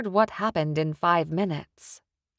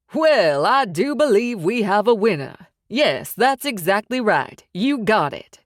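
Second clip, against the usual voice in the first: louder, mocking game-show cheer; gloating mob boss; 3 semitones higher, 6 dB louder than usual. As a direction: crow over the win like a host. Sykes mockingly congratulates John.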